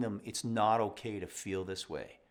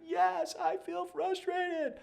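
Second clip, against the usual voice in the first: put-on high, slow little kid answer; child's voice; slower than usual. Parent acting out child saying they feel frustrated.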